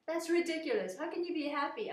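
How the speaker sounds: high, loud outburst of disbelief; scoffing king. Acted. King scoffing at his happy page, loud and high. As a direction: shout it like a king who can't believe it.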